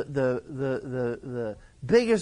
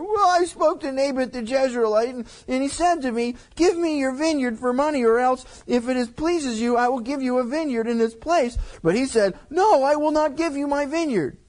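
whiny king; 6 semitones higher than usual. Acted. Ahab whining retold to Jezebel.

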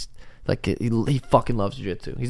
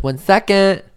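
put-on high little-girl voice, lightly done; toddler voice; 9 semitones higher, 5 dB louder, slower than usual. Dad imitating his daughter brushing her teeth. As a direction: chirp it like a busy toddler. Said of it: "She goes".

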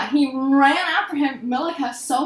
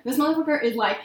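ordinary talk

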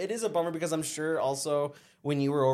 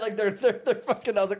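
usual speaking voice

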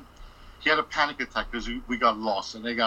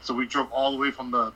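ordinary talk

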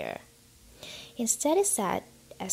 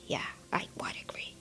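hushed, much softer sinking-hearted reply; 7 semitones lower, 10 dB quieter than usual. Acted. Husband agreeing with his wife with sinking heart, hushed. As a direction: let the agreement sink to a near whisper. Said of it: "his heart sinking horribly".

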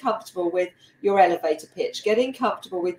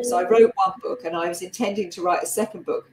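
ordinary talk, nothing marked

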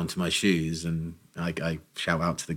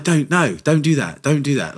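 higher and louder, urgent begging; pleading; 7 semitones higher, 6 dB louder than usual. Retold or imitated. Begging Steph not to tell the shoe story, raised and urgent. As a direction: stop her before the cameras roll.

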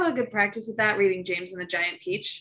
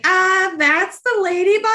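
put-on loud, high excited kid guess; drawn-out, high mock exclamation; kids piping up; 12 semitones higher, 9 dB louder, slower than usual. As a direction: pipe up loud and high like a kid shouting the answer. Voicing kids' reaction to story voices.

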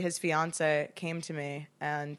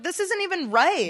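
imitated indignation, pitched way up; mock pissed-off protest; 10 semitones higher than usual. Imitating her co-host pissed at crying, high mock indignation. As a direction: mimic a friend upset at her own crying. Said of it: "you're pissed because you're like".

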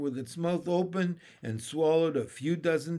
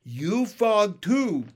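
high, loud, slow froggy voice; drawn-out, gleeful pronouncement; excited proclamation; 6 semitones higher, 7 dB louder, much slower than usual. A narrator's reading. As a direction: declare it grandly, stretching each word. Pin proclaims to froglet.

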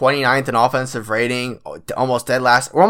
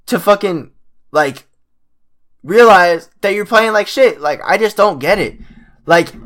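raised, louder exasperated rant at the team; frustrated rant; 7 semitones higher, 5 dB louder than usual. Real, not acted. Fan venting at the Clippers for playing badly, exasperated loud rant. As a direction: let the frustration boil over, loud and high.

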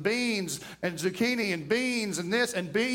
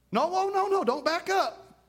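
much higher, urgent; quick no no no; 8 semitones higher than usual. Real, not acted. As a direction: stop them backing out. Cutting off a backtracker.

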